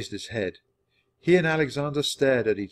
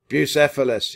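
loud, raised call; shout; 5 semitones higher, 7 dB louder than usual. A narrator's reading. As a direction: call the horse out loudly. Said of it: "he shouted".

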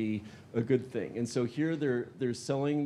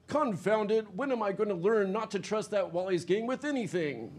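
fuming mobster; 8 semitones higher than usual. Acted. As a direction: curse the gang in frustration.

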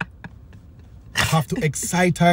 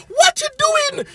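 squeaky high mock outrage. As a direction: jump way up into a shocked squeal. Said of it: "I said".

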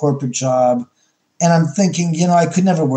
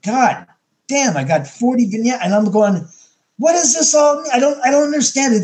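exasperated; 8 semitones higher than usual. Retold or imitated.

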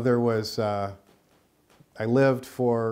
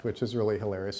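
ordinary talk

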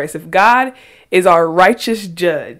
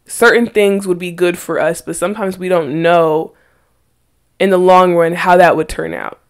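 normal talking voice

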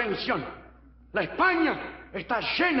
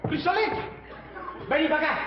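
high-pitched calls to a straying animal; calling out; 6 semitones higher than usual. Acted. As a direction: coax the cow over from afar. Llama a la vaca que se aleja. (llamando con voz aguda)

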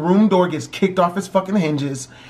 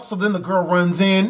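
ordinary talk, nothing marked

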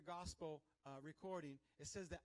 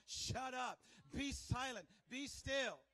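loud, raised, punched orders; aggressive command; 5 semitones higher, 7 dB louder, much slower than usual. Shown as a demonstration. Believer telling off the devil, aggressive loud command. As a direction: fire off each command with force. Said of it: "to the devil, you can tell him".

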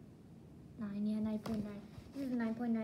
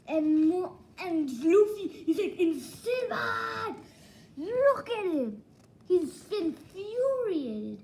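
loud, high, drawn-out fan outburst; worked-up high shout; excited shouting; 7 semitones higher, 7 dB louder, slower than usual. Real, not acted. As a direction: yell it slowly, stretching the words in excitement. Excited about Luffy's fury in book.

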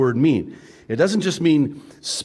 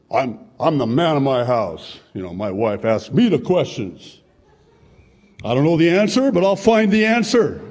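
lightly voiced proud husband; 4 dB louder than usual. Husband insisting he leads his house, lightly acted bluster. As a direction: lightly play a husband asserting himself, rising to a loud finish.

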